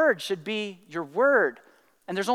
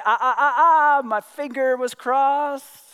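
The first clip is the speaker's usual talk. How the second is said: drawn-out, teasing 'ah, ah, ah'; singled-out syllables, then a slow taunt; gloating excuse; 3 semitones higher, 3 dB louder, slower than usual. Acted. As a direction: wriggle out of it like a kid caught out. Voicing a childish excuse.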